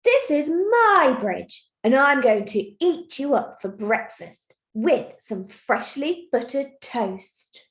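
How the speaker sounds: loud, drawn-out troll threat; angry troll. Acted. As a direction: stretch and punch it like a shouting troll. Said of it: "Say the sentence like the troll".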